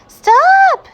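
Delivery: very high, loud alarmed shout. A narrator's reading. Amelia Bedelia shouting at the cat carrying her kitten. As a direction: yell to stop the cat. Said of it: "shouted Amelia Bedelia".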